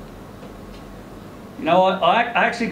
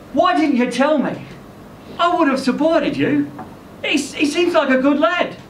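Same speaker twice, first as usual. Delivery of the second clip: higher woman's voice, gently reproachful; Lynn questioning; 8 semitones higher than usual. Acted. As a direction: ask why she kept it from him. Lynn confronted about secret son.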